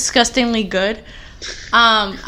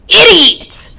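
retold yell; 4 semitones higher, 11 dB louder, much slower than usual. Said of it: "And she's like".

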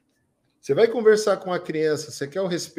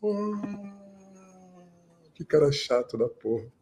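bored audience; 4 dB quieter, slower than usual. Retold or imitated. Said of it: "a plateia".

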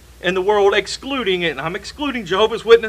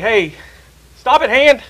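sharp, higher call to stop; scolding the hand; 6 semitones higher, 6 dB louder than usual. Retold or imitated. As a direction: tell the hand off firmly. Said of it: "and you, you're like".

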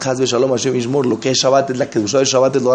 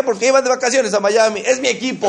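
put-on childish fan's reason; 7 semitones higher than usual.

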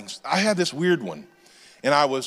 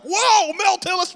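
excited whoa; 14 semitones higher than usual. Retold or imitated. Father-in-law starstruck at the news, excited high whoa. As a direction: gush over the famous name. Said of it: "he was like".

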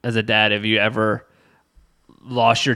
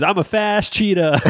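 put-on higher, playful little-kid voice; imitated kid, pitched up; boasting child; 9 semitones higher than usual. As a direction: brag like an excited small child.

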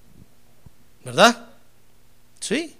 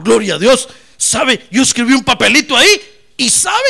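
joyful testimony; 7 semitones higher, 11 dB louder, slower than usual. Retold or imitated. Congregant's joyful testimony quoted.

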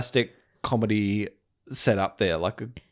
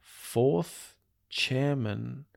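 slow puzzled villain voice; 6 dB quieter, much slower than usual. Retold or imitated. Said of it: "Nakahara is like".

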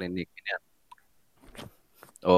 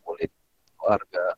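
choppy call audio; bad connection; 4 dB louder than usual. Real, not acted. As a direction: voice cutting in and out. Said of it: "Suara lu putus-putus". (suara putus-putus)

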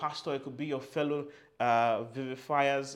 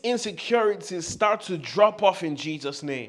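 high and loud, building fervour; fervent, shouted affirmation; loud fervent declaration; 6 semitones higher, 11 dB louder than usual. Shown as a demonstration. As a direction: preach it with full force. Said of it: "As loud as you can".

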